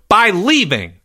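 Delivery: high, emphatic retort; exasperated exit line. A narrator's reading. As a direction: throw it back as you storm off. Charlie storms out in a huff.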